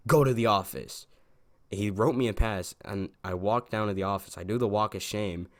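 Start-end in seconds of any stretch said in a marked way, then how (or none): 0.1-1.1 loud mock teacher's command; retold stern order. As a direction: imitate a teacher loudly dismissing a pupil. Teacher sends student to the office.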